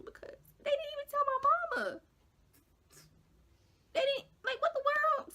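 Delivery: very high, squeaky mock crying; getting emotional. Upset that they never told her mama, mock tearful. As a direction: break into a hammed-up tearful squeal. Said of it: "sorry, I'm getting a little emotional".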